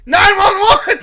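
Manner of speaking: high, very loud yell breaking into laughs; exasperated shout. Retold or imitated. Teen answering the screaming stranger, exasperated shout. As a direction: yell back the obvious answer.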